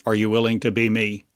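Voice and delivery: ordinary talk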